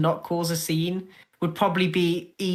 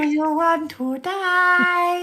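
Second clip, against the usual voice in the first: much higher, very slow, hammed-up menace; mock threat; 10 semitones higher, much slower than usual. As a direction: drag out the threat like a playful bully.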